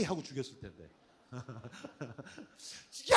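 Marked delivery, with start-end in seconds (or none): 2.9-3.2 mock shout. Jonah shouting again.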